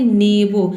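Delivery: नेबो with no r and a long final o; model pronunciation. Shown as a demonstration. Teacher shows learners the right way to say it. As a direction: say नेबो, dropping the r, stretching the o. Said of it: "आपको बोलना है".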